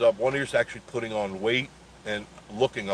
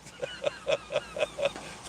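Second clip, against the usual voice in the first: high squealing laugh; 7 dB quieter than usual. Real, not acted. Laughing at the raccoon falling, high squeal.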